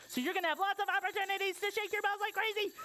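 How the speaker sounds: high, fast excited promise. Real, not acted. Excited promise to preschool children.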